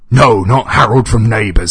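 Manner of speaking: loud, high-pitched shout; exasperated scream. A narrator's reading. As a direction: yell the correction in outrage. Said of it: "screamed the voice".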